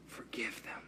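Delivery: barely a breath, whispered. Acted. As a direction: breathe the words out softly. Jesus's words on the cross.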